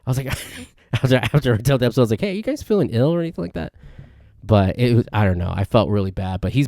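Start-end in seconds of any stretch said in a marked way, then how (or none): 2.2-3.7 nervous checking. Retold or imitated. Acts out nervously checking on friends.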